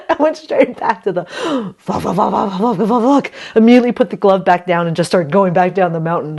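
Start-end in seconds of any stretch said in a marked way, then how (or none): 0.1-3.3 laughing curse. Real, not acted. Cursing at ripped nail.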